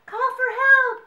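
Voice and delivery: high, loud panicked cry. A narrator's reading. Bibi thinks Max fell in, crying out. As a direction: cry out for help in storybook panic. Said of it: "cried Bibi".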